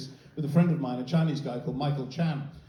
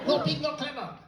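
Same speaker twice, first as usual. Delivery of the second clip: high-pitched, softer telling-off; mock scolding; 10 semitones higher, 5 dB quieter than usual. Comedian to audience wanting the accent, mock scolding. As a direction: playfully chide them in a high voice.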